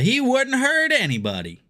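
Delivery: over-the-top fake Southern accent, slow; exaggerated Southern accent. Reading a chat comment in a fake Southern accent. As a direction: ham up a Southern drawl until it sounds fake. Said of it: "an obviously Southern accent ... you feel like they're faking it".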